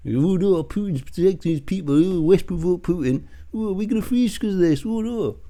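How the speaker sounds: high-pitched mock lament; put-on voice, wailing in pretend dismay; mocking whine. Parroting his pub patter back at him, high and mocking.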